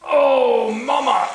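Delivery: strained shout while lifting; straining cry. Real, not acted. Lifting heavy car, cries out.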